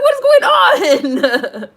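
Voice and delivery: high, loud wail breaking into laughter; helpless, incredulous laughing shout; laughing bewilderment. Retold or imitated. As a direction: cry it out slowly, then crack up. Retelling own bafflement at plot.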